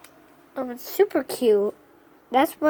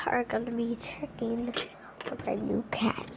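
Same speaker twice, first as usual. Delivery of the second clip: whispering; 4 semitones lower, 12 dB quieter than usual. Real, not acted. Whispering to not wake cat.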